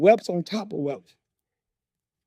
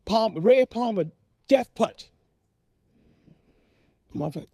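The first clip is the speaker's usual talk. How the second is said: ordinary delivery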